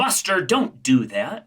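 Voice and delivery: drawn-out, playful telling-off; mock dog scolding. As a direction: pretend to tell off your dog.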